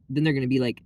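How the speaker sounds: ordinary talk, nothing marked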